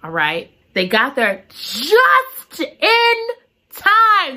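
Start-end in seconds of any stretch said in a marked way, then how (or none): 1.5-4.3 slow, rising to a high, loud time; slow build to a high loud time; dramatic suspense. Real, not acted. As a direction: hold the tension, then release.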